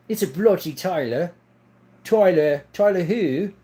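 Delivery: Australian with a bit of Irish twist; chatty put-on Aussie accent, questions lilting up; wobbly Australian-Irish accent. Shown as a demonstration. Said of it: "when I do my accent that sounds like I'm from Australia".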